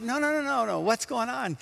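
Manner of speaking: high, playful "no, no, no" of a nosy friend; mock insistent pressing. Nosy friend pressing a smiling person for news, high mock insistence.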